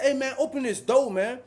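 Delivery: loud, high plea through door; yelling. Retold or imitated. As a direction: shout to be let out. Yelling at officer.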